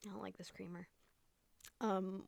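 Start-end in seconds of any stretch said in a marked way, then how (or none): 0.1-0.9 unvoiced, disappointed aside; whispered grumble. Real, not acted. Tasting bad creamer, whispering to herself. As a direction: mutter it in a whisper.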